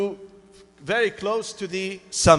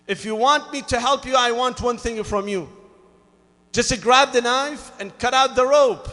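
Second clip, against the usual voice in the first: firm insistence; 7 semitones higher, 8 dB louder than usual. Acted. God insisting on one condition.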